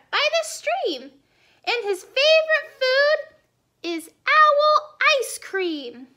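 high storybook mouse voice; high mouse, drawing out the threat; bluffing little mouse. Acted. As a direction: describe the monster in a high, teasing voice. Mouse describing invented Gruffalo.

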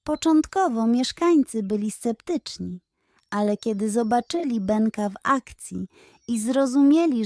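ordinary delivery

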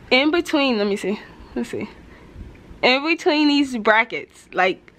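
speaking with finger on lip; finger pulling lip down. Real, not acted. Seen 0: Close-up: fingertip holds lower lip down, showing braces, while speaking.

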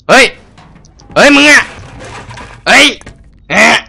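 high, loud shocked shout; startled yell. Real, not acted. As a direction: scream at the sudden enemy. Enemy appears suddenly. (ตะโกนตกใจ)

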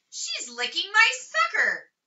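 high, loud complaint; telling on her at the top of his voice; loud tattling. A narrator's reading. Wayne tattles loudly on Ramona to the class. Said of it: "interrupted Wayne in a loud voice".

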